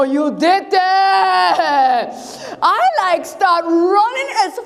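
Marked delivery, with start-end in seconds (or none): 0.0-2.1 stretched-out outraged yell at the lion; outraged shout. Acted. David yelling at the lion that grabbed his lamb, stretched-out outraged shout. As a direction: drag it out and shout it at him.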